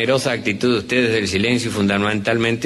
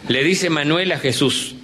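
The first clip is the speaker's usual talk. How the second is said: ordinary talk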